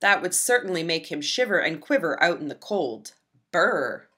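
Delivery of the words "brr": ordinary delivery, nothing marked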